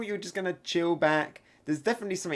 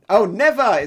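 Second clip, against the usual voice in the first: loud, higher-pitched defiant shout; defiant cry; 5 semitones higher, 10 dB louder than usual. Acted. Manga character refusing to give up, defiant shout.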